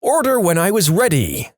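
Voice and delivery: hammed-up disbelief; imagined indignant retelling. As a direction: pretend to be scandalized by a simple request. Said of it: "imagining these people relaying stories like this".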